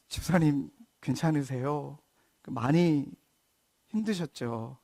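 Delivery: soft, slow and caring question; gentle comfort. Retold or imitated. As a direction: comfort a weeping church member softly. 목사가 우는 집사님을 위로함. (우는 집사님을 다정하게 위로하듯)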